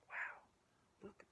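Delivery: soft breathy whispered wow; a hushed little wow. Real, not acted. Hushed aside.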